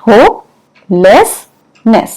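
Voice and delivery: hopelessness said very slowly, syllable by syllable; broken into parts. Shown as a demonstration. Teacher to students, breaking the word up slowly for spelling. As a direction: stretch hopelessness out part by part so it can be spelled. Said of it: "आपको ऐसे break करके लिखना है".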